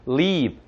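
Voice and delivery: "leave" dragged out as "lee-eev"; two-beat long ee. Shown as a demonstration. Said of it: "두 번에 걸쳐서".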